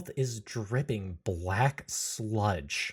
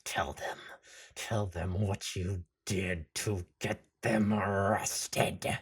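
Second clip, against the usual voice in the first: slow, low, drawn-out whisper; sinister frog whisper; 4 semitones lower, 4 dB quieter, slower than usual. Acted. Party Frog orders Goopy Frog to confess, sinister whisper. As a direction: whisper it like a creepy creature.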